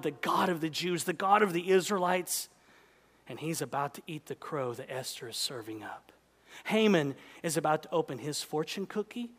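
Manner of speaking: normal talking voice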